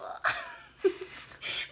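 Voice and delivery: a high, airless little giggle; breathy embarrassed giggle. Real, not acted. Admitting her crush to her partner, breathy giggle. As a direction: giggle without voice, shy and high.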